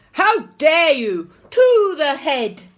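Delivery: loud, high, outraged order; furious scream. A narrator's reading. Teacher sending Peter to the head, outraged scream. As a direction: thunder it at the culprit. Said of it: "screamed Mister Nurden".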